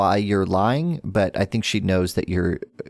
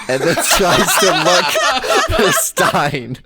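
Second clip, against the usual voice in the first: loud, high narration through laughter; words slowed by real laughter; laughing; 8 semitones higher, 5 dB louder, much slower than usual. Real, not acted. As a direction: crack up while telling it. Game master cracking up while narrating.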